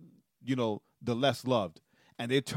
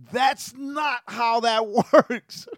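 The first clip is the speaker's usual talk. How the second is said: laughing disbelief; 9 semitones higher, 5 dB louder, much slower than usual. Real, not acted. Laughing at a preacher's claim.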